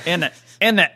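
playful British imitation; British accent. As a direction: toss out a British tag question. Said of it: "you know how the British accent".

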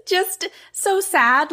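high, slow, pitiful sigh; mock sad. Agreeing with co-host about the frog, high and pitiful. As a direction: pout it out slowly and high.

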